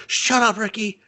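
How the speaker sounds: high-pitched frantic rant; high exasperated snap. Retold or imitated. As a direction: blurt it out, fed up. Jamal snapping back at Ricky.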